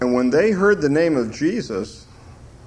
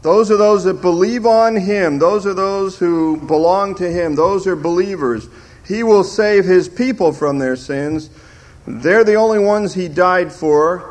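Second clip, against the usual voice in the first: raised, higher mock-insistent arguer; hammed-up, louder lecturing voice; imitating opponents; 6 semitones higher, 5 dB louder than usual. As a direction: parody a confident teacher of the other view. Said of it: "the way they do that is they say".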